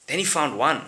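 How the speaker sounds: ordinary talk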